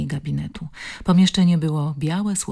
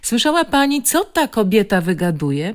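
higher and louder, pointing the finger; accusing tone; 6 semitones higher, 5 dB louder than usual. A narrator's reading. Nurse complaining to Zuzanna about Baśka, accusingly. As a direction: accuse the patient to her friend. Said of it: "oskarżycielskim tonem".

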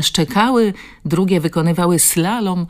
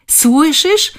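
a much higher, louder joyful cry; shout; 12 semitones higher, 6 dB louder than usual. A narrator's reading. Zuzanna crying out to her friend in joy, shouting. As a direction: shout it out, jumping up from the table. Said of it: "krzyknęła Zuzanna".

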